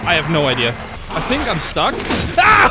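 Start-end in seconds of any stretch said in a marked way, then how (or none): 2.4-2.7 short, loud high scream; startled yell. Real, not acted. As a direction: yelp at a sudden scare. Stuck and attacked.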